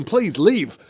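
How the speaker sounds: ordinary talk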